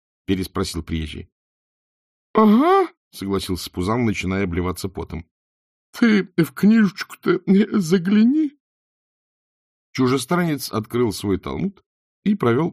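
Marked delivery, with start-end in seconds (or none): 2.3-2.9 very high, loud yelp; strained falsetto yes; squeaky agreement. A narrator's reading. Nervous innkeeper agrees, sweating. 5.6-8.6 high, loud, slow urging; nervous falsetto prompting; squeaky innkeeper. A narrator's reading. Squeaky innkeeper urges the foreigner.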